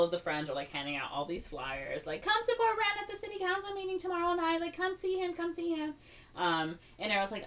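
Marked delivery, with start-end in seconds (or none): 2.2-5.9 much higher, fast and bright; peppy flyer pitch. Acted. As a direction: hype the crowd. Friends hand out flyers.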